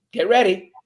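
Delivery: loud, punchy self pep talk; energized pep talk. Shown as a demonstration. Fingers out each morning, loud and pumped up. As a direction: pump yourself up out loud. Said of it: "I take my fingers and I go".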